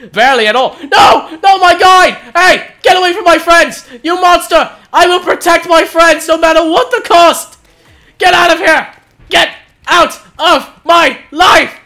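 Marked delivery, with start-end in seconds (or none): 2.8-11.8 hammed-up hero, loud; heroic speech. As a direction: bold mock battle cry. Hammy heroic speech to monster.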